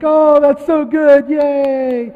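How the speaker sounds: high, loud, drawn-out mock cheer; gushing praise. Family applauding a four-year-old's singing, gushing. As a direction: cheer as if for a cute little performer. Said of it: "everyone would be like".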